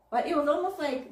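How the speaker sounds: ordinary delivery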